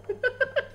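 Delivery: high-pitched burst of giggles; high giggling; thrilled laugh. Real, not acted. Thrilled after a game kill.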